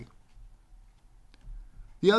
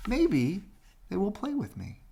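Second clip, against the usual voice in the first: higher, hopeful little voice; hopeful duckling voice; 10 semitones higher than usual. Acted. Duckling hoping the baby birds will play, high and hopeful. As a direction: wonder aloud like a lonely duckling. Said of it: "thought the ugly duckling".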